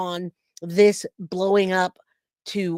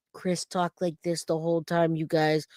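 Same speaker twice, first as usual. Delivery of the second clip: softer, subdued even tone; low-key even tone; 6 dB quieter than usual. Retold or imitated. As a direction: imitate his coached, level delivery. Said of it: "He talked like this whole tone".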